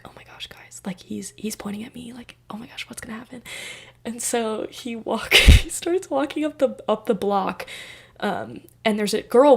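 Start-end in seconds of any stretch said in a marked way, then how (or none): 0.0-3.4 hushed, breathless whisper to friends; panicked whisper. Retold or imitated. Telling friends he points at her, soft whisper. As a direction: whisper so he can't hear.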